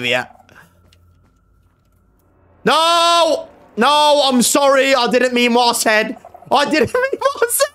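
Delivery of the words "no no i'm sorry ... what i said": high-pitched frantic apology; terrified high begging; panicked pleading. Real, not acted. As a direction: wail an apology, breaking into a squeal. Panicked apology to game enemy.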